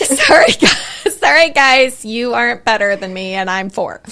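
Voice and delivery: loud, high, smug apology; cocky kid. Retold or imitated. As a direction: gloat like a bold four-year-old. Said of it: "yeah, I was like".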